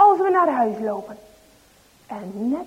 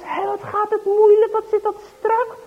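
higher, lightly voiced struggling boy; a hint of straining boy; 7 semitones higher, 5 dB louder than usual. Dries tugging at the tight tarp, straining. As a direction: grunt it out as if pulling hard.

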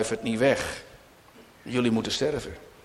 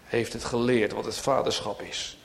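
ordinary talk, nothing marked

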